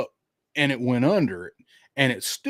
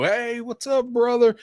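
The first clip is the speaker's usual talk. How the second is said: hearty greeting; 11 semitones higher than usual. Pretend. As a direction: act out a grand reunion greeting, pitched up. Imagined grand meetup greeting, acted.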